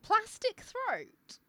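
high, drawn-out disbelieving question; baffled query. Real, not acted. Co-host querying the odd phrase just read, baffled.

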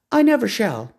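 high, proud woman's voice; lofty woman naming the horse. A narrator's reading. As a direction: announce the horse's new name.

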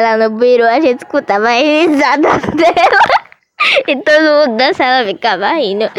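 usual speaking voice

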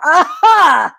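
loud, high gotcha cry; triumphant pounce. Acted. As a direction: burst out with it as you catch your prey. Coyote pounces on the rabbit.

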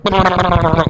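high, rapid rattling demon noise; mock demon noise. Comedian chasing the frightened man out, mock demon noise. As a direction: make a high stuttering monster sound. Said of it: "ran after him going".

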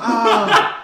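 a loud, high fake scream of pain; fake injury scream. Player fakes a hamstring injury to the referee, hammed-up scream. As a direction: ham up a scream, clutching the hamstring. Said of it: "I was like".

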